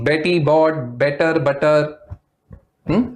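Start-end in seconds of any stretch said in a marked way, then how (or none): 0.0-2.0 slow, each B crisp in Betty bought better butter; slow teaching of the B in Betty bought better butter; slow B sounds. Shown as a demonstration. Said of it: "all the similar sounds of B".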